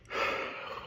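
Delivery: heavy breathless sigh. Real, not acted. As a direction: let out a tired, breathy sigh. Catching breath mid talk.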